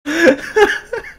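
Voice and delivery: breaking into a squeaky laugh; high giggle. Real, not acted. Streamer reading the story, giggling.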